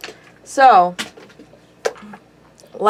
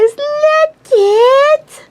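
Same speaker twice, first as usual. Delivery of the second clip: high, loud squeal; thrilled show-and-tell; excited; 4 dB louder than usual. Real, not acted. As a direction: squeal for them to look. Showing off her drawing.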